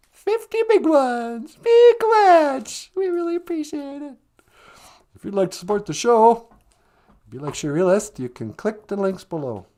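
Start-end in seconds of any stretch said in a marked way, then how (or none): 0.1-4.1 loud high mock thank-you voice; hammed-up thank-you. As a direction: ham up the thanks in a high put-on voice. Super chat thanks, put-on voice.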